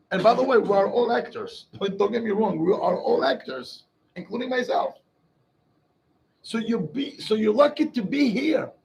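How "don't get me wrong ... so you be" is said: ordinary delivery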